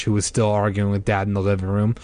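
ordinary delivery

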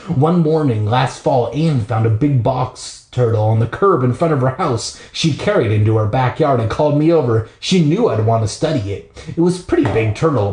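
plain reading voice

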